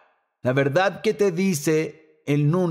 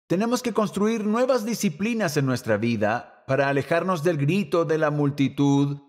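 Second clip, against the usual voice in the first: ordinary talk, nothing marked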